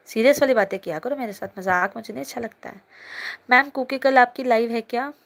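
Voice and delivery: ordinary talk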